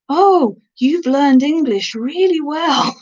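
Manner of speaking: high, drawn-out mock compliment; imitating patronising praise. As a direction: stretch it slowly, like impressed praise for a foreign learner. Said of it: "he, he just went".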